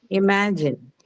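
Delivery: Imagine with weight on middle MA; stress on ma. Shown as a demonstration. Teacher repeats Imagine for students, stressing MA. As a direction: lean on MA in Imagine, then fall. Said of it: "ma is the one that will take the stress".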